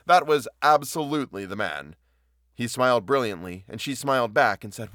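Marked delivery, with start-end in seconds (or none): 0.0-2.0 "absolutely" punched high and loud; louder, certain, with weight on "absolutely"; emphatic. A narrator's reading. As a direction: lean hard on "absolutely". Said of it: "the florist said emphatically".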